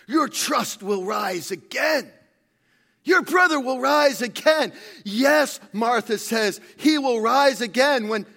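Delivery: loud, high, ringing sermon delivery; full-force preaching. Acted. Preacher retelling Martha's hope to the congregation, full-force preaching. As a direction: preach it at full volume, building to the promise.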